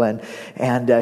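usual speaking voice

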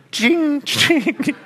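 high, sharp pen-stroke sound; mock red pen ching. Preacher to congregation, mimicking a red pen's high ching. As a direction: mimic a teacher slashing wrong answers. Teacher marking a test in red.

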